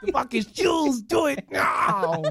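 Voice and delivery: high, drawn-out action-hero holler; Arnold Schwarzenegger. Acted. Ordering shoes done, Arnold-style drawn-out yell. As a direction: stretch the last word in an Austrian roar. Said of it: "knowing how to do Arnold Schwarzenegger".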